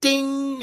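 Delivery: high imitated text-alert chime; imitated message tone; phone ding. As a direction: sing out a high phone notification. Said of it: "I hear".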